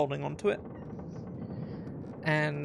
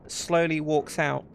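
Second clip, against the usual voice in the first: ordinary delivery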